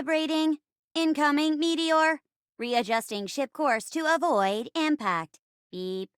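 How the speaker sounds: measured, synthetic robot delivery; navigator robot. Acted. Robot in a space play reporting, mechanical voice. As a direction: report it like a ship's computer. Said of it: "playing the role of the navigator robot".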